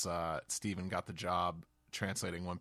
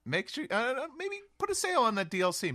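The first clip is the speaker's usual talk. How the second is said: high mock spokesman voice; 7 dB louder than usual. Joking as a company spokesman.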